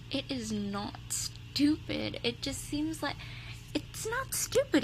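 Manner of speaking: ordinary talk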